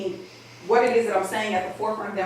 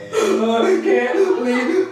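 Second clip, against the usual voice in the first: mock ugly cry; 5 semitones higher, 7 dB louder than usual. Shown as a demonstration. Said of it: "You know what the ugly cry is?".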